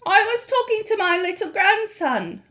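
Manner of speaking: put-on high, easy old lady voice; a lightly voiced grandmother. A narrator's reading. Grandmother voicing.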